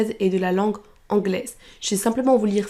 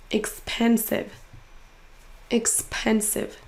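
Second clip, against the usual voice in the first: slow "Expensive" with PEN stressed; stressed syllable drawn out; 5 dB quieter, much slower than usual. Shown as a demonstration. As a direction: stretch the middle syllable of "Expensive". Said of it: "en faisant traîner un". (deuxième syllabe de « Expensive » traînée)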